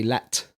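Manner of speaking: "let" for lit, with an E sound; Welsh vowel change. Shown as a demonstration. Co-host modelling for the learner, lit as "let". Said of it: "Lit would be".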